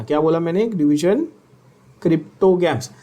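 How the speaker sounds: usual speaking voice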